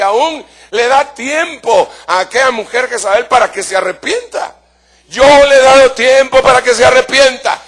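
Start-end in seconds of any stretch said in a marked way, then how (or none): 5.1-7.6 solemn booming voice of God, higher and louder; God's warning, raised and louder. Acted. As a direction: proclaim it as God speaking. Quoting God's words in sermon.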